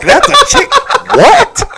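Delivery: high-pitched laughing shock; laughing disbelief. Real, not acted. Shocked by the cheating story.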